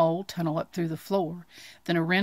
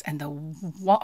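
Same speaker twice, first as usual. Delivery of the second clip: plain reading voice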